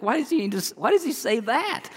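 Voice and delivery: imitating a puzzled listener, pitch rising high; mock confused question from the pews; lightly voiced baffled churchgoer. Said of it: "You're like".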